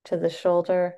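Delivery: unhurried yoga instructor cues with long pauses between; guided breathwork cueing. Acted. Yoga teacher guiding the class through neck stretches, guided breathwork cueing. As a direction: pace each cue to the breath.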